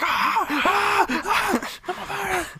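very high sung wails; [name] wailing. Retold or imitated. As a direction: wail high over banging keys. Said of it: "he's like".

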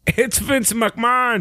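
high, slow dramatic reveal; announcer reveal. Pretend.

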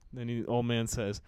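ordinary talk, nothing marked

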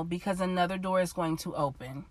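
ordinary talk, nothing marked

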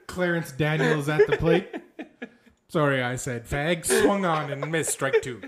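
loud baseball play-by-play, half laughing. Acted. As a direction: announce the play like a commentator. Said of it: "such a baseball voice".

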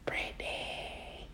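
long, drawn-out eerie whisper; ghostly voice. Retold or imitated. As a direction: whisper the name slowly, like a ghost. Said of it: "And it was just like".

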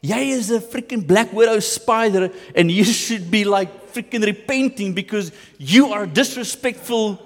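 husband mock-accusing his wife; mock accusing rant. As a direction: throw the blame at her, hammed-up.

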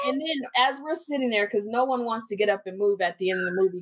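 ordinary talk

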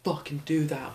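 acted-out outrage without shouting; mock angry protest. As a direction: protest like a riled-up small kid. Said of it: "gets mad".

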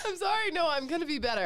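high, teary laughing apology. Real, not acted. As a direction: still giggling while promising. Recovering from a laughing fit.

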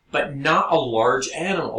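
usual speaking voice

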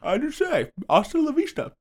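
high, put-on toff voice; monocled Terminator. Terminator with a bifocal saying his catchphrase, high put-on voice. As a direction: play a fussy Terminator with a monocle. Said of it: "What if the Terminator had a bifocal".

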